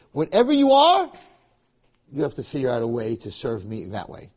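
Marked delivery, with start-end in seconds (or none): 0.1-1.1 big booming rise in volume and pitch; emphatic cry. Retold or imitated. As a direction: call it out loud and high. God's decree retold emphatically.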